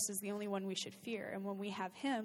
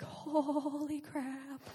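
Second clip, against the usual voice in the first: slow, drawn-out holy crap; stunned whisper; 5 semitones higher, much slower than usual. Retold or imitated. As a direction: say it slowly, in disbelief. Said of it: "I kinda was like".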